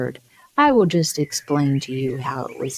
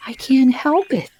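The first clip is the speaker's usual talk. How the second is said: put-on higher, timid girl's plea; meek Alice; 10 semitones higher, 4 dB louder than usual. A narrator's reading. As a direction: apologize meekly as a small girl. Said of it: "said Alice very meekly".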